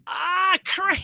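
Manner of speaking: very high, strained yelp; crying out in pain. Real, not acted. Reader cut off by a thigh cramp, yelping in pain. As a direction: squeal as the cramp seizes again. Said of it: "that freaking hurts".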